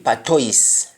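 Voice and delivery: "patois" said pa-toys, s sounded; "patois" with oy in the second syllable; standard English pronunciation. Shown as a demonstration. Teacher giving the form to avoid, "patois" as pa-toys. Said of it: "instead of O I S being patois".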